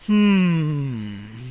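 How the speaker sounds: low thoughtful vampire hum; murmuring vampire. A narrator's reading. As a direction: ponder them. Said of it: "he murmured".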